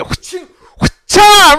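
high, loud mock stab sound; drawn-out, explosive "wha-cha" sound effect; imitated stabbing noise. Retelling the stabbing to co-hosts, loud imitated stab noise.

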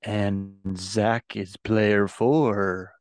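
slow, drawn-out ghost voice. Pretend. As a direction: stretch it out like a ghost. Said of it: "we all went with ghost voice".

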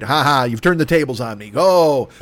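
usual speaking voice

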